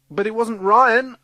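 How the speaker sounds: high, loud, drawn-out protest; protesting child. Acted. Child protests to scolding teacher.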